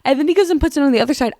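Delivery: normal talking voice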